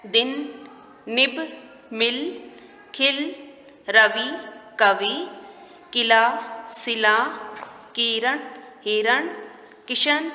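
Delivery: measured, one word at a time; teacher dictating to beginners; word-list reading. Shown as a demonstration. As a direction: leave a clear pause after each word.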